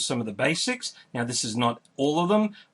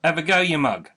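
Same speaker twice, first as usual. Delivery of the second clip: mild demo call-out, louder and higher; 4 semitones higher, 5 dB louder than usual. Shown as a demonstration. Cheering on someone who is not sure, calling out. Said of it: "So you'd call out".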